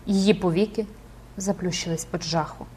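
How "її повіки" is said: usual speaking voice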